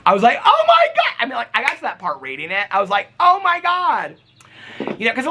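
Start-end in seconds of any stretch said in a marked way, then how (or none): 0.4-1.1 excited gasp. Retold or imitated. Reacting to the cameo request. 3.2-4.2 surprised gasp. Retold or imitated. Reading the cameo request.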